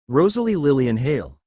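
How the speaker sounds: ordinary delivery, nothing marked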